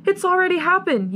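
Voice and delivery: high, agitated shriek. A narrator's reading. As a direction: shriek it back at him. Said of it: "you shriek".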